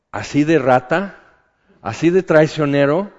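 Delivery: indignant outburst. Retold or imitated. Preacher retelling his angry reply to the devil, loud and indignant. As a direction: scold yourself for betraying God. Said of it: "Y me enojé".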